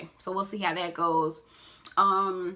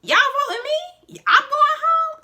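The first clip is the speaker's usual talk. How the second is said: mock shock; 8 dB louder than usual. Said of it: "she was like".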